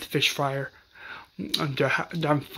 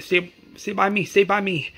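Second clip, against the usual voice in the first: higher, louder retold urging; urgent plea; 6 semitones higher, 4 dB louder than usual. Carol urging Sophia to stay close during the attack. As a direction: keep her close, a touch panicked.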